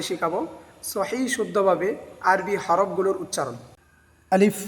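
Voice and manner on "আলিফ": Arabic letter name said for students to copy; Arabic letter alif. Shown as a demonstration. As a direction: clear teaching demo of আলিফ. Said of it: "উপরের দাঁতের আগা নিচের ঠোঁটের পেটের সাথে লাগবে".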